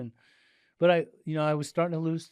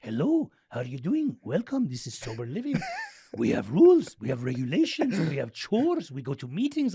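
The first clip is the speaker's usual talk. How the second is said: Persian Yoda voice; 2 semitones higher, 5 dB louder than usual. Retold or imitated. Sober living manager welcoming a new resident, in a lightly imitated Persian accent. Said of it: "Sounded like Yoda with a Persian accent".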